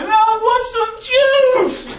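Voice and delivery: put-on high, loud whining child's voice; whiny toddler. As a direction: whine it like a toddler demanding juice.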